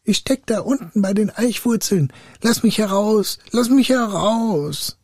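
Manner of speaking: high, pleading little voice; high captive-spirit wail; trapped spirit. A narrator's reading. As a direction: call up from below, stretching the last plea. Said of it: "Die Stimme antwortete".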